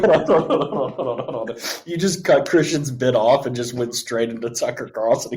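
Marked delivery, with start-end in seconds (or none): 0.3-1.6 higher, laughing hold on; laughing interruption. Real, not acted. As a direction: break in while cracking up. Cutting in, laughing.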